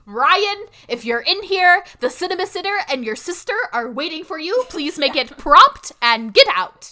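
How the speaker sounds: hammed-up bossy summons, ending loud and deliberate; Nanny McPhee. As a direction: call through a door with stern authority, slowing at the end. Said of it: "She's Nanny McPhee, basically".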